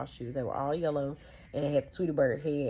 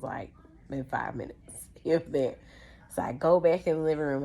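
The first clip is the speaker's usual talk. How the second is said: plain reading voice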